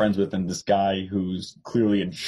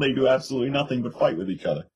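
ordinary delivery

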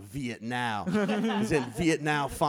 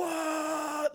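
high mock shocked cry; imitating a stunned audience; crowd gasp. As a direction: act out the crowd erupting.

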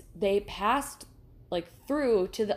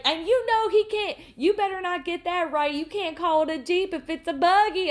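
much higher, louder mock scold; waitress scolding jokingly; 9 semitones higher, 6 dB louder than usual. Waitress scolding the table, high mock voice. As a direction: wag a finger in a twangy mock voice. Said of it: "then she was like".

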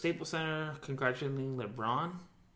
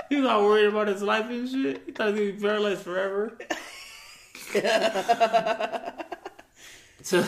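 laughing; 9 semitones higher, 10 dB louder than usual. Real, not acted. Laughing retelling of the friend.

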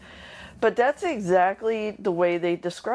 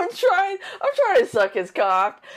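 stifled giggles; 6 semitones higher, 4 dB louder than usual. Real, not acted. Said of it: "I'm trying not to laugh".

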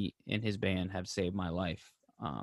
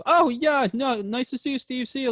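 much higher, hammed-up brush-off; dismissive rock star; 13 semitones higher, 5 dB louder than usual. Imagined singer brushing the fan off, high mock brush-off. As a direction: fake politeness while leaving.

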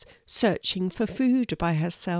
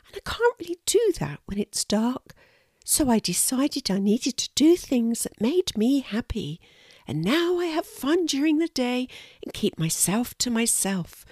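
high small-creature voice; a little squirrel telling her story earnestly; confiding little squirrel; 6 semitones higher than usual. Acted. Lulu confides how she learned to be happy, high and earnest. Squirrel's dialogue, raised pitch.

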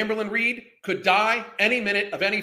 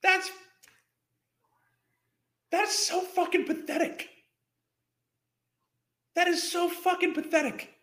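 disgusted rant; 9 semitones higher than usual. Real, not acted. Ranting in disgust at video.

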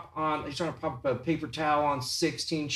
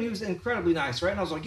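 ordinary talk, nothing marked

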